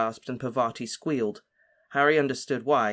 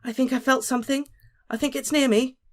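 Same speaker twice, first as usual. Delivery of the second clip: put-on much higher, scared whisper-like girl; frightened; 12 semitones higher, 3 dB louder than usual. A narrator's reading. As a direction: tremble with fright. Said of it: "shivered and pressed herself closer".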